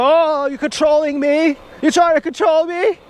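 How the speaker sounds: high-pitched outraged mimicry; hammed-up indignant wail; angry mock screech. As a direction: imitate her furious accusation, voice shooting way up. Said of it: "She got so angry".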